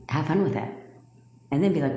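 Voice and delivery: normal talking voice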